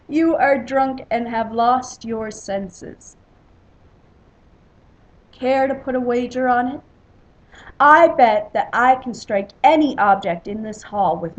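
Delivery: loud, boastful wager; loud drunken-hall challenge. A narrator's reading. Warrior bets on his spear throw, loud boasting. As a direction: dare a rival at full voice. Drinking rivals argue and wager.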